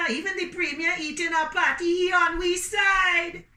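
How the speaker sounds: high, loud amazed Jamaican passerby; Jamaican patois. Acted. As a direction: exclaim in Jamaican patois, thrilled. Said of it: "And people walking by said".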